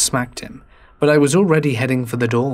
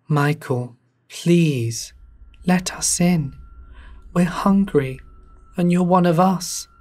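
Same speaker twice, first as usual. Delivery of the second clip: eerie plea; 5 semitones higher, slower than usual. Acted.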